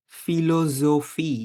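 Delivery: ফিলোজফি stretched out very slowly, each f clear; teacher stretching a German word for learners; slow model word. Shown as a demonstration. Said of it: "বাংলা ফ এর মতো".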